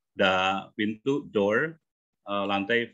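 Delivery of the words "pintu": ordinary delivery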